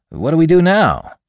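high, loud, excited question; eager newcomer. Acted. Player asks for the next move in the game, eager. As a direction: ask it pitched well up.

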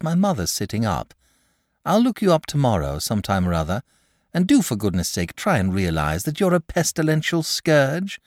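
put-on mildly exasperated boy; firm parting lecture with lifted pitch; boy character voice. A narrator's reading. Boy leaves the dragon with a warning, exasperated.

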